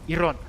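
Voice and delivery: iron said "ee-ron" with a tapped R; Finnish pronunciation. Shown as a demonstration. Streamer showing how Finns say it, Finnish pronunciation. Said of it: "Like, like Finnish people say".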